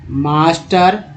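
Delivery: ordinary talk, nothing marked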